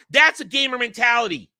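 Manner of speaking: ordinary delivery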